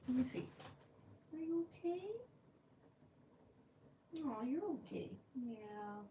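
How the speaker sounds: soft, high cooing; hushed, high-pitched reassurance; soothing pet talk. Real, not acted. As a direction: comfort a hurt pet very softly.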